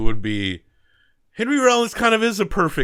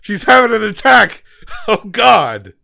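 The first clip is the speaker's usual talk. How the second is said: high, loud mock alarm; acted panic; 11 semitones higher, 11 dB louder than usual. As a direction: cry out in hammed-up panic. Acting out a movie attack scene.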